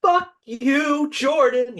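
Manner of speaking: mock cathartic shout. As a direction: belt it like the payoff of the final chorus. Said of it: "I feel like he's earned this".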